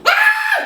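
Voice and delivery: very high, loud shriek; panicked scream. Retold or imitated. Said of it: "이러는 거야".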